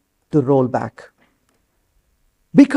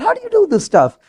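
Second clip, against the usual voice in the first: amazed question; 11 semitones higher, faster than usual. Retold or imitated. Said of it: "they said".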